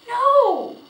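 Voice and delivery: high, loud wail of refusal; desperate cry. A narrator's reading. Girl refusing to give up the horse, crying out. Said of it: "cried Felicity".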